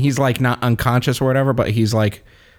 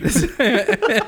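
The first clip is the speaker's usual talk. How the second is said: laugh; 12 semitones higher than usual. Real, not acted. Laughing at own story.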